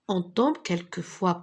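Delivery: ordinary delivery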